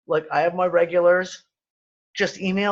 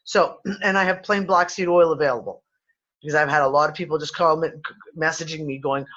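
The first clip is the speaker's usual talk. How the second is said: normal talking voice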